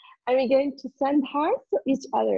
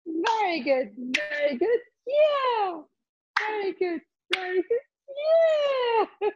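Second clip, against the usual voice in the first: cheery praise; 7 semitones higher than usual. Acted. Laughter yoga cheering.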